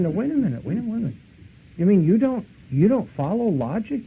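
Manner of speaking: mock astonishment, pressing the point; incredulous. Speaker pressing the Hare Krishna in disbelief. Retold own incredulous objection.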